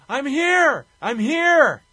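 high, loud holler; Ric Flair yelling. Retold or imitated. Wrestler answering his partner's call, loud high yell. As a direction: yell it out high at full volume, both times. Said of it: "And Ric Flair yells".

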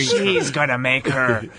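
slow, hammed-up announcer imitation; Vince McMahon. Joining in to mock the announcer, exaggerated commentator voice. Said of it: "he was doing it with Vince McMahon".

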